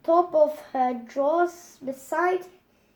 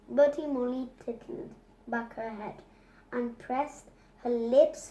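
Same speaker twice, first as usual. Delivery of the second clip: ordinary delivery, nothing marked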